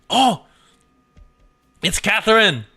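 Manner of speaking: high, loud burst of delight; excited fan. Real, not acted. Streamer spotting favourite character Catherine in the game, real excitement. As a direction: whoop as a favourite turns up.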